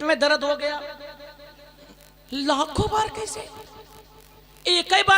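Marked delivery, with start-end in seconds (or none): 2.3-3.4 high, scoffing mimic of a critic; mock incredulous objector; mocking question. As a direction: ape the doubters' whining question.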